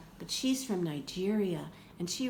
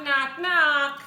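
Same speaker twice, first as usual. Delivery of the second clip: mock knocking; 5 semitones higher, 7 dB louder than usual. Narrator knocking on Omu's door for the children, loud and high.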